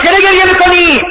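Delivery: high, insistent questioning; retold incredulous question. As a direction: act out a husband challenging tears. Husband questions weeping wife.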